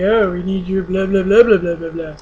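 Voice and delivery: loud, dismissive blah-blah mimicry; mocking the office staff. Retelling the doctor's office being dickbags, mocking. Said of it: "they were being dickbags about it".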